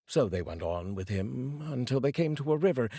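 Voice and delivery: plain reading voice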